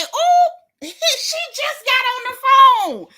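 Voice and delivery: high, loud, drawn-out laughing squeal; shocked whoop, cracking up; hysterical reaction. Retold or imitated. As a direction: howl it slowly through laughter.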